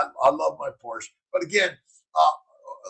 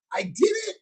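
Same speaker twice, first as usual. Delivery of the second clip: excited; 14 semitones higher than usual. Real, not acted. Finishing five-day fast.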